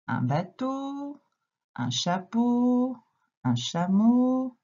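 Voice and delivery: slow, high, stretching each final eau; drawn-out eau endings. Shown as a demonstration. Teacher to child, stretching the O endings slowly. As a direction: stretch out bateau, chapeau and chameau so the O sound rings. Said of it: "Qu'est-ce que tu remarques?".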